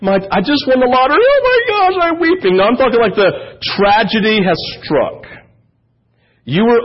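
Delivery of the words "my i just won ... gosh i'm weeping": hammed-up squeal of lottery joy, pitch jumping high; mock overjoyed gushing with a high squeal; mock happy weeping. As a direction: gush like a lottery winner pretending to cry. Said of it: "not like".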